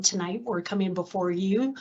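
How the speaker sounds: ordinary talk, nothing marked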